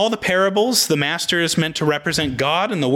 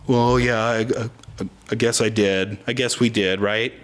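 lower, halting admission; sheepish concession; 5 semitones lower than usual. Acted. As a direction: back down haltingly, lightly acted. Workers sheepishly concede to the master.

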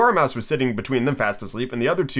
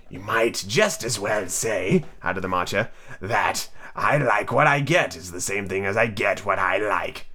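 March Hare; 5 semitones lower, 4 dB quieter, slower than usual. Acted. March Hare voiced low.

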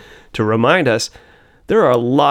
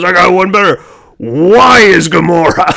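Drax voice; 7 semitones higher, 9 dB louder than usual. Retold or imitated. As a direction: boom it out like Drax. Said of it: "they cut to Drax and goes".

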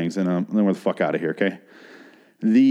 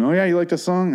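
higher, teasing imitation; mock taunting girlfriend; 8 semitones higher than usual. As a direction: needle him in a higher voice.

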